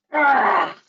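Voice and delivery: high, loud sudden scream; a startled shriek in the dark; scream in the night. Retold or imitated. Scream heard in the tent.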